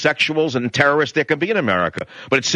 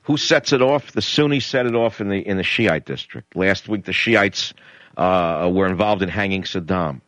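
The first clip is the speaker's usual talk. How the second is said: ordinary delivery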